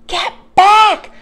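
high, loud shouted warning; alarmed shout. A narrator's reading. Jack yelling at Annie near the Triceratops, alarmed shout. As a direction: shout it sharply at full voice. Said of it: "Jack shouted at her".

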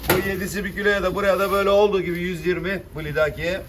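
loud, rhythmic price calling; auctioneer. Acted. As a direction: call out the lot to the crowd.

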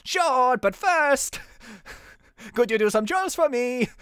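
high, hammed-up Mexican accent. Rocky asking the detective for chores, mock Mexican accent. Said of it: "Or maybe, like, Mexican".

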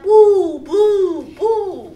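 boos high and loud; spooky boo. Acted. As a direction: scare the kids with boo. Scary pumpkin head goes boo.

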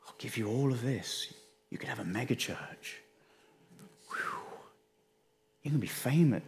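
a lightly voiced Satan tempting a pastor with fame; hushed Satan tempter. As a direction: lure them with quiet promises. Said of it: "That verbing".

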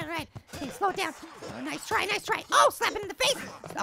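ordinary delivery

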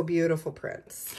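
normal talking voice

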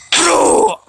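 high loud cry of protest; shocked shout. Real, not acted. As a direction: yell it in disbelief. Shouting at pedestrian.

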